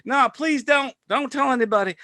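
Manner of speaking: high-pitched, hammed-up begging; playful, high pleading voice; mock pleading. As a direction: imitate someone begging for secrecy. Acted locals begging him not to tell.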